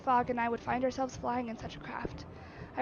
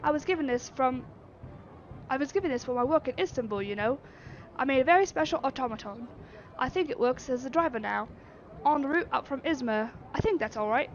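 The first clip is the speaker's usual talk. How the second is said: put-on Yorkshire accent. Artificer chats proudly.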